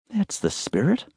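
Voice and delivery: a hint of a wry young woman; young woman's voice. A narrator's reading. Emma voiced, higher.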